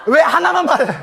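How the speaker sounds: loud, high laughing question; laughing loudly at the omission. Real, not acted. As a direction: toss it out between laughs. Laughing at the story.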